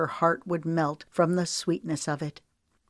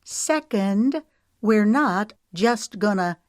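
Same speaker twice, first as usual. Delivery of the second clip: higher and louder, each word hammered; indignant enunciation; 5 semitones higher, 4 dB louder, slower than usual. A narrator's reading. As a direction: keep hitting every word, indignant. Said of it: "enunciating every word".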